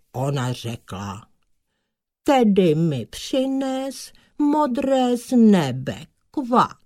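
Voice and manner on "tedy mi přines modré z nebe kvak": high, slow, grand demand; a vain frog making a lofty demand; haughty frog princess. A narrator's reading. Frog princess sets her suitor a task, haughty.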